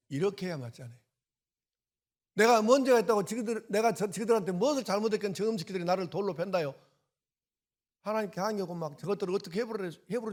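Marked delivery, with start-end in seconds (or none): 2.3-10.3 louder, higher indignant grumbling; mock angry complaint. Pastor acting out a resentful believer's protest, angry. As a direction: rant like a wronged man protesting to God. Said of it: "우리가 평소에 살던 대로 하면".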